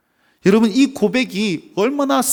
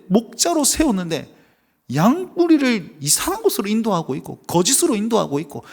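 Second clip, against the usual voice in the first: usual speaking voice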